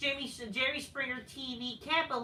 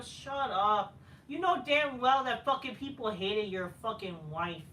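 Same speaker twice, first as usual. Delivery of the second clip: usual speaking voice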